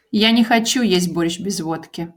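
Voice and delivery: "Я не хочу есть борщ без водки" said fast, at natural speed. Shown as a demonstration. Teacher repeating the sentence at native speed. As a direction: rattle it off the way natives say it. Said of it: "I don't want to eat борщ without vodka".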